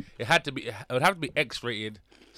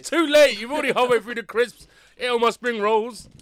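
indignant shout; 11 semitones higher, 10 dB louder than usual. Real, not acted. Complaining about eaten food.

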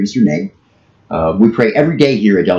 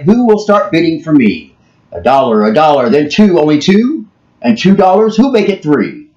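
climbing auctioneer bidding call, higher; 8 semitones higher, 4 dB louder, slower than usual. Retold or imitated. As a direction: push the bids up, pitch climbing. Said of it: "he cried".

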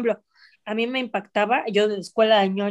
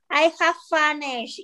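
put-on higher-pitched, slow kid's English; little girl; 6 semitones higher, slower than usual. Little girl speaking English in class, childish mispronunciation. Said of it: "una niña decía".

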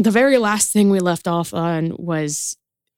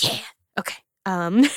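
ordinary delivery

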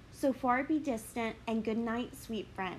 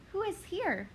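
sudden higher alarm; startled; 8 semitones higher than usual. Acted. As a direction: gasp at the figure on the ground. Discovers body.

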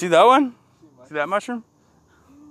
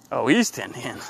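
ordinary talk

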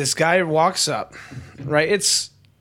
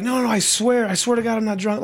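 put-on high, insistent drunk denial; mock desperate protest; 6 semitones higher than usual. Drunk traveler protests to airline staff, high mock-desperate plea. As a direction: imitate a drunk pleading innocence. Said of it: "he's like".

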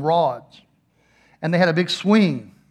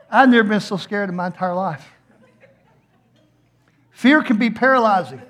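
ordinary delivery

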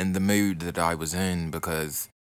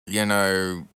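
ordinary delivery

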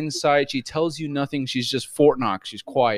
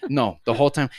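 ordinary talk

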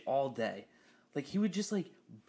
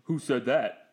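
louder, laughing quiz voice; quizzing nerd; 2 semitones higher, 7 dB louder, slower than usual. Retold or imitated. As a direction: tease like a movie-quote quizzer. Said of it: "look at me and be like".

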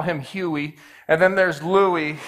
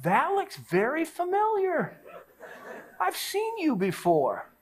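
high, slow wondering voice; a slow realization, voice lifted high; slow suspicious recognition; 11 semitones higher, 4 dB quieter, slower than usual. Retold or imitated. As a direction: squint and drawl it out with suspicion. Recognizing the guy.

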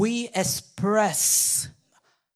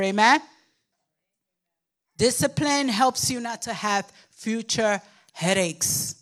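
ordinary delivery

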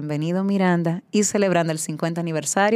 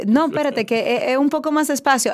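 higher-pitched mock halt; acted interruption; 7 semitones higher, 3 dB louder than usual. Singer stopping the racing pianist, acted interruption.

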